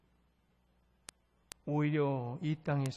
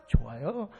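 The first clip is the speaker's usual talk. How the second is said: low answer with a lilting rise; low-pitched, unbothered reply; calm acceptance; 3 semitones lower than usual. Acted. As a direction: answer the challenge easily and contentedly. Acted calm answer to shouted question.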